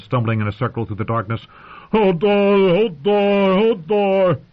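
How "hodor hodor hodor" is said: high, loud run of roars; roaring. A narrator's reading. Hodor stumbling in terror through the dark, roaring. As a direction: roar it over and over in panic. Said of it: "he roared".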